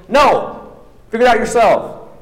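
a rude tired teammate snapping at a friend; curt refusal. Retold or imitated. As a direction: brush him off sharply.

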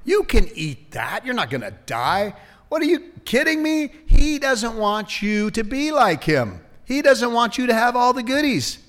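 high-pitched, scoffing tempter; wheedling devil. Acted. Devil tempting Adam and Eve to eat, high scoffing wheedle. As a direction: talk them into it with mock disbelief. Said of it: "The devil said".

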